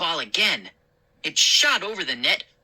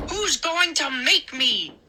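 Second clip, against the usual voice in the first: defiant; 8 semitones higher than usual. A narrator's reading. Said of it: "he demanded".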